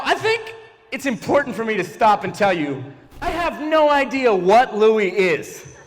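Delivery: normal talking voice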